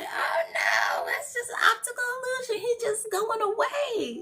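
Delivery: high, mock-dismissive voice of science; mocking scientist. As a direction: sneer it like a smug scientist. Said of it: "But our science will say".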